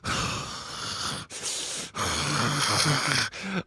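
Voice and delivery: long soft mock sigh; a broken husband exhaling; defeated sigh. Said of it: "And her husband's like".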